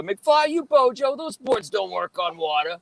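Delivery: high, drawn-out movie punk taunt; hoverboard punk. Acted. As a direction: jeer like a punk, stretching the words. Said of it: "the guy that says".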